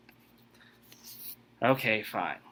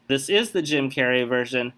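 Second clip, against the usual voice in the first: ordinary talk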